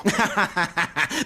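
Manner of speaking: scoffing burst of laughter; amused laugh. Acted. Commandant laughing at talk of a criminal pastor, amused. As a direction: laugh it off as ridiculous. Commandant finds the accusation ridiculous.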